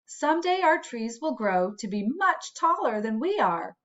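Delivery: ordinary delivery, nothing marked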